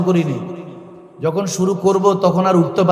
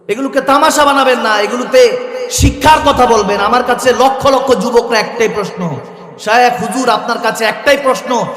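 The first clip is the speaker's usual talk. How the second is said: raised, forceful sermon delivery; impassioned preaching; 5 semitones higher, 4 dB louder than usual. Real, not acted. Preacher pleading against making gatherings a spectacle, impassioned preaching. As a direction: drive the plea home, building louder.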